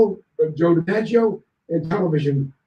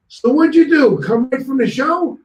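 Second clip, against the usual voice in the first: DiMaggio calling over; 7 semitones higher, 3 dB louder than usual. Retold or imitated. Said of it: "Joe DiMaggio yells over".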